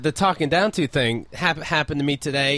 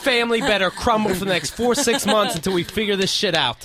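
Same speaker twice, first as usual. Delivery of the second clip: higher, hearty company-man enthusiasm; mock delighted boss; 5 semitones higher than usual. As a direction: pounce on it with gleeful mock approval. Mock company reply to weary parent.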